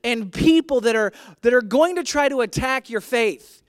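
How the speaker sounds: loud, high, emphatic preacher delivery; forceful preaching. Real, not acted. Preacher urging the church to stand firm, forceful preaching. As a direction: hammer each phrase home to the congregation.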